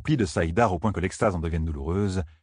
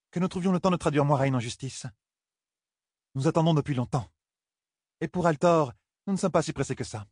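higher-pitched, lightly voiced woman; woman pressing her case; 7 semitones higher than usual. Galina urges bringing Moiraine to justice, lightly insistent. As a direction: argue it in a higher character voice.

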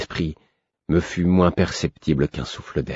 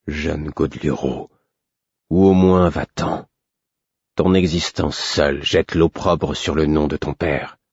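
cavernous voice. Acted. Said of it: "de sa voix caverneuse".

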